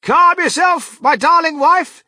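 very high, loud call to a wife; excited old husband at full volume; urgent soothing call. A narrator's reading. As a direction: call over the panic to settle her. Calls out to panicking wife.

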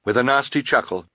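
usual speaking voice

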